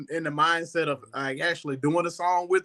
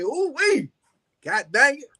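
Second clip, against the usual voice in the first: higher clean take on the catchphrase; 9 semitones higher than usual. Retold or imitated. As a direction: say it playful without the cuss. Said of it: "I didn't wanna cuss".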